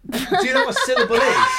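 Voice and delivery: high, fast, through laughter; laughing disbelief. Real, not acted. Laughing at a wrong charades guess.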